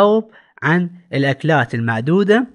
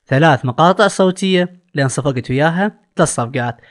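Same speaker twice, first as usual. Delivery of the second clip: usual speaking voice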